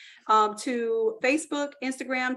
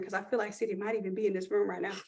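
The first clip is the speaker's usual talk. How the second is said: usual speaking voice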